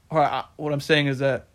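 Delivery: ordinary delivery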